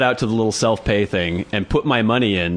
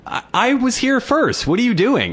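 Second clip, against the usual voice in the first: indignant; 12 semitones higher than usual. Retold or imitated. Arguing with queue jumper.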